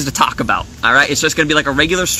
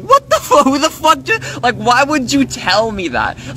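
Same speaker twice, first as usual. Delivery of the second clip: outraged shout; 11 semitones higher, slower than usual. Retold or imitated. Told editors moved on, high outraged yell. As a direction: blow up in disbelief.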